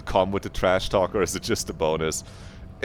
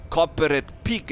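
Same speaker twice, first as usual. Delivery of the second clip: corporate pig in a terrible put-on French accent; 8 semitones higher than usual. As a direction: spit it like a cartoon Frenchman. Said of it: "as you would say".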